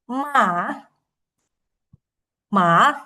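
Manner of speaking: MA drawn out, down then up; dipping third tone. Shown as a demonstration. Teacher reviewing tone three, MA dipping then rising. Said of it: "Kemudian nada tiga itu".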